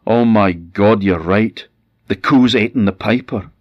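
husband aghast at the cow's supposed meal; shocked dismay. Acted. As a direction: blurt it out in shock. Husband sees the boots and feet.

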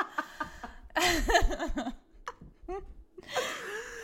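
long, high, loud laughing fit; real sustained high laughter; helpless laughter. Real, not acted. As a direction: keep cracking up. Cracks up at own story.

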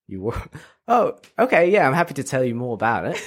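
high, eager mock British accent; terrible British accent. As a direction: jump on the lead in a hammy British accent. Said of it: "it's like this terrible British accent".

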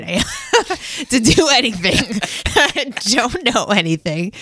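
real laugh breaking into the words. Real, not acted. Admitting to friends how unqualified she feels, laughing. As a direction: laugh helplessly while protesting.

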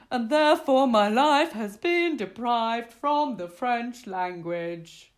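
loud, slow, hammed-up proclamation; grand, drawn-out lament; mock-tragic declaration. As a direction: declaim it like a great injustice. Mock-tragic declaration after story.

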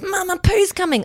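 put-on high-pitched small child warning mum; Wolfie. Toddler warning mum at the shop, urgent child voice. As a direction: imitate an urgent little boy. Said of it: "and then Wolfie goes".

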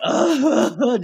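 loud, high hammed-up sob; mock ugly cry. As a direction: imitate ugly crying, overdone. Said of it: "just ugly crying".

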